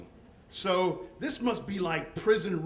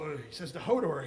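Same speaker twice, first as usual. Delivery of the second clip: normal talking voice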